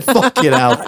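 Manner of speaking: high-pitched retold amazement; mock astonishment. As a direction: play up the disbelief, pitched high.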